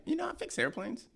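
high, soft, slow exasperated complaint. Retold or imitated. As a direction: whine it at the teachers. Retold complaint to school.

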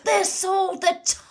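high, slow, put-on Thatcher voice; Margaret Thatcher. Friends joking about The Crown, mock Thatcher voice. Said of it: "What about Margaret Thatcher".

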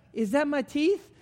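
high, loud, panicked question; mock alarm. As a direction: blurt it out as a worried patient. Acts out paranoid thought in chair.

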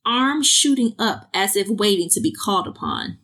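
normal talking voice

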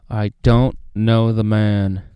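a loud, slow, forceful "I don't know the man"; worked-up denial. Acted. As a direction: deny it hard and loud, each word pressed out slowly. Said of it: "very worked up at this moment".

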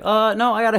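high, loud, flustered stammer; flustered panic. Retold or imitated. Tim panicking as he pushes her out, flustered. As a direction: scramble to get rid of her.

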